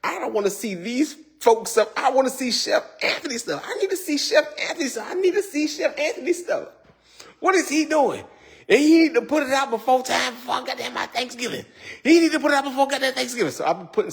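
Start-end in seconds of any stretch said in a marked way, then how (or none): 0.0-8.2 high whiny demanding viewer voice; mock impatient fan pleading, pitched high; playful high-pitched fan impression; imitating viewers. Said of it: "I know you guys are like". 8.7-13.1 high complaining fan voice; mock viewer.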